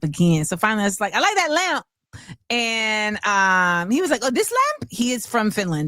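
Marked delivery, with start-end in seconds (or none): 1.1-1.9 much higher, speaking up at last; spoken-up compliment. Retold or imitated. Woman finally telling a stranger she likes his lamp, piped up high. Finally tells the man aloud. 4.2-4.9 high-pitched, surprised question as the stranger; imitated Finnish man. Stranger from Finland asked about his lamp, imitated in a high voice. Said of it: "I can't do the accent".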